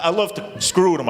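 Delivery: ordinary delivery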